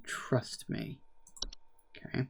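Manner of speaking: breathy whisper, barely voiced. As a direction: lean in and whisper it.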